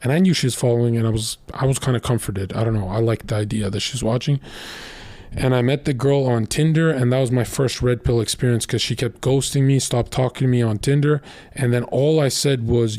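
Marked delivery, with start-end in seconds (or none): none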